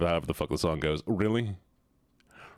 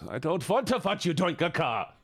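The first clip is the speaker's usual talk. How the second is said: higher, exasperated mock German outburst; emphatic German-accented protest; 9 semitones higher than usual. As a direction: repeat it insistently with a thick German accent. Repeats protest more emphatically.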